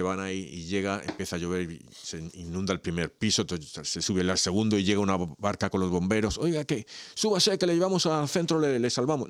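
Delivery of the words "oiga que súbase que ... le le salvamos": fireman calling. Acted. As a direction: call him aboard as a fireman, pitched well up. Firemen in boat voiced in joke.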